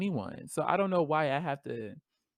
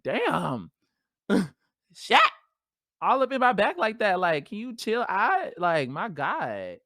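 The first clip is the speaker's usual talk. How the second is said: pained complaint; 6 semitones higher, 4 dB louder than usual. Retold or imitated. Bra hurting her back.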